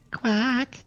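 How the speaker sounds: high squeaky duck quack. Pretend. Players quacking like ducks.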